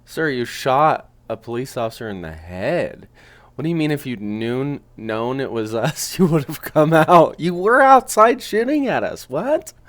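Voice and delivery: can't believe what he hears; incredulous. Real, not acted. Reactor stunned by suspect's claim.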